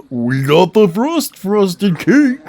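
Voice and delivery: slow drawled character read. Acted. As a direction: voice the game character slowly. Reading character dialogue aloud.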